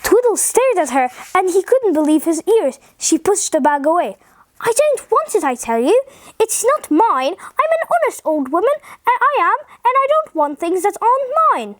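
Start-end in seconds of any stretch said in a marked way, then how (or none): 4.6-11.8 high-pitched indignant refusal; storybook old woman insisting, swooping high. A narrator's reading. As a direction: protest honesty as an affronted old lady, pitched up. Old lady indignantly refuses the bag.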